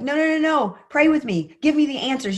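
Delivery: high, pleading, panicked rush; desperate pleading. Retold or imitated. Friend telling her to pray, she begs back in desperate pleading. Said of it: "when you're desperate".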